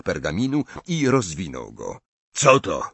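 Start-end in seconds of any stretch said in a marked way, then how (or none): 2.3-2.8 loud, high, angry demand; sharp rise into anger; angry question. A narrator's reading. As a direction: spit out the question indignantly. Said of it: "zapytał Gaunt ze złością".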